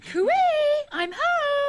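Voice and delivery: high sing-out homecoming call; calling out on arrival. Acted. Sue arriving home to the others, calling out. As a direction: call brightly through the house.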